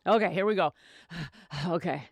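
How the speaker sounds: usual speaking voice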